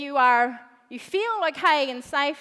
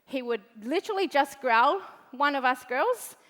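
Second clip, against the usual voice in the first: normal talking voice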